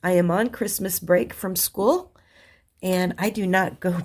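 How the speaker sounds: ordinary talk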